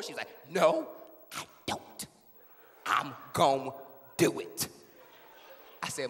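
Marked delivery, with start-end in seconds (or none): 0.5-4.7 halting, part-whispered threat; dramatic refusal. Acted. Mom defies her pleading child, halting and breathy. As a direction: break it up with pauses, dropping into whisper.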